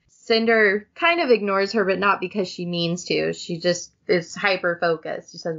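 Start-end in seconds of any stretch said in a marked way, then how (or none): none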